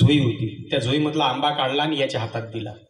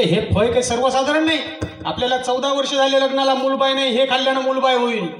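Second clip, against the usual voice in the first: eager husband; 8 semitones higher than usual. Acted. Husband eagerly explaining fruit.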